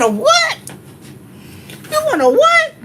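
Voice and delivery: high, loud incredulous "A what?"; hammed-up baffled father; imitating surprised dad. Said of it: "He said".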